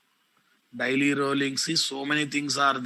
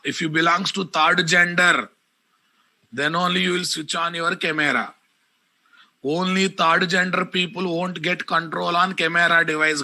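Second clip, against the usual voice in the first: raised, loud, scolding teacher; angry scolding; 3 semitones higher, 4 dB louder than usual. Real, not acted. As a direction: tell the class off loudly. Teacher scolding students about cameras.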